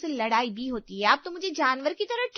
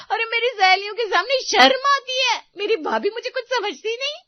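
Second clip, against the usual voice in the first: louder, higher aggrieved complaint; upset complaint; 6 semitones higher, 7 dB louder than usual. Acted. Sunita complaining loudly to mother-in-law. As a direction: plead your grievance loudly.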